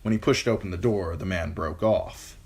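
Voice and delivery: ordinary delivery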